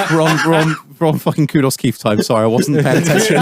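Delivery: ordinary talk, nothing marked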